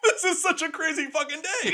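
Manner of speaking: can barely get it out for laughing. Real, not acted. Both laughing at the story.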